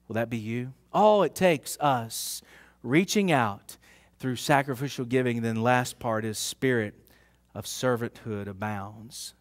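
hushed heartfelt preaching; soft preaching. Acted. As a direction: draw them in gently.

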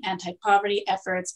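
usual speaking voice

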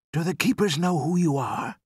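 put-on high-pitched child's voice; questioning boy. A narrator's reading. Son asking his trapped father, boy's voice. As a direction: ask as an anxious young boy.

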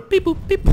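very high beep-boop noises; mock computer beeps. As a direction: play at being a computer. Pretends to work a computer.

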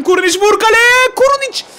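high, loud shout at the striker; excited shout. Real, not acted. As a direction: yell him toward goal, rising. Gol pozisyonunda heyecanlı bağırış. (gol anında heyecanla bağırış)

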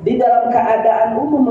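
ordinary delivery

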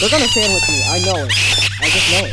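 loud exasperated shout; frustrated shouting. Real, not acted. Player expecting the monsters to trap him, loud frustrated shout. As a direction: shout it, sure you're doomed.

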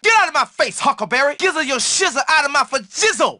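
usual speaking voice

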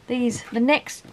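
ordinary delivery

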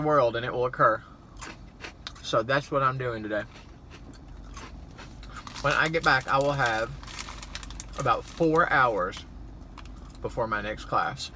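chewing while talking; snacking between words; mouth partly full. Real, not acted. Seen 0: Bites cheese puff at -1, speaks, lifts another to lips.

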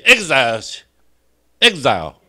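exile drawn out syllable by syllable, ek-zile; teacher modelling exile slowly; slow demo word. Shown as a demonstration. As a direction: spell out exile very slowly for the class. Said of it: "来，大家做伙读一摆".

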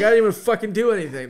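loud quick complaint; indignant protest. Retold or imitated. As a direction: fire it back fast and outraged. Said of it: "I'm like".

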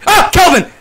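high, loud yelp of his name; startled shout. Real, not acted. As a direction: jump at the sudden fright. Friend startles him in dark.

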